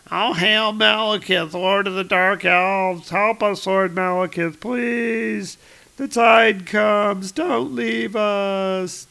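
high pleading wail of the dead; wailing ghost voices. Acted. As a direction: moan it like drowning spirits. Dead souls begging Malekith.

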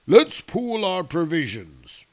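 slow, loud giant voice; giant alien. A narrator's reading. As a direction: rumble slowly like a giant. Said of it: "Skrymir said".

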